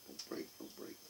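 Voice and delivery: whispered plea to a jar. Real, not acted. Whispering to the cup not to break.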